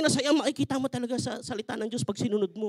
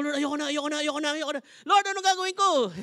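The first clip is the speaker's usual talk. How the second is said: whining husband; 4 semitones higher than usual. Retold or imitated.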